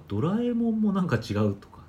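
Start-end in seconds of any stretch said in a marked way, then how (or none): 0.1-0.8 ドラえもん said high and loud; pitch-accent demo of ドラえもん; ドラえもん with a marked regional accent; odd pitch accent. Shown as a demonstration.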